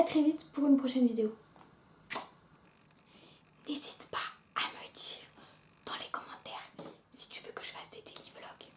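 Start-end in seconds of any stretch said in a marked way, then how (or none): 3.7-8.7 close-up whisper to the camera; secretive whispered aside. Acted. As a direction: whisper it right into the lens, very softly. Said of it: "La meuf complètement tarée qui zoome sur elle et qui dit ça".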